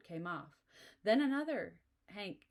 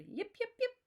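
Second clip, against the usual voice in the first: much higher yapping dog cries; husky yips; 5 dB louder than usual. A narrator's reading. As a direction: yip like excited huskies in the wind. Dogs heard yipping outside.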